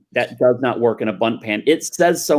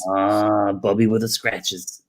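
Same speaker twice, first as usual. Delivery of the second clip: grandmother voice; 5 semitones lower, slower than usual. Pretend.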